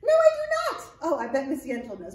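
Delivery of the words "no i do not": high, bright little monkey puppet; squeaky puppet voice. Acted. Librarian voicing Arturo the monkey puppet to the children, high excited puppet voice. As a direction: pitch way up and chatter like an excited puppet.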